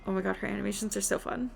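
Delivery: ordinary talk, nothing marked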